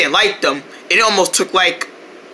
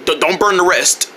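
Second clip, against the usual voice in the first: ordinary delivery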